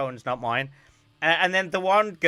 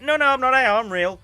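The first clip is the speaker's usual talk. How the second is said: emphatic denial; 8 semitones higher, 8 dB louder than usual. Retold or imitated. Mia quoted denying being AI.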